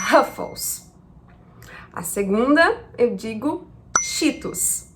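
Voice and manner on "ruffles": Ruffles with a breathy Portuguese H sound, "Háfous"; Brazilian Ruffles. Shown as a demonstration. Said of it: "eu falo".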